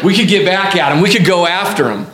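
lightly voiced Southern old-timer; resume the old-timer with a hint of Southern accent; old-timer's Southern accent. Preacher resuming an old-timer's talk of payback, mild Southern accent. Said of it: "I gave him a southern accent".

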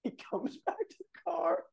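very high, squeaky, breaking with laughter; laughing. Real, not acted. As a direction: wheeze it out while cracking up. Cracking up over the ending.

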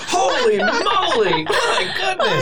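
high, slow laughing disbelief; amazed laugh. Real, not acted. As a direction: drag it out, stunned and laughing. Shocked at Ronald Reagan choice.

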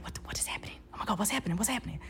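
frantic hushed questions; panicked whisper. Retold or imitated. Just woken in the tent, panicked whisper. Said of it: "And they're whispering".

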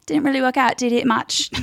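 high passive-aggressive British shade; petty British co-host. Acted. Co-host seeing off a loser, petty. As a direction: shade delivered in the closing question. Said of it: "question on the end that is the biggest shade".

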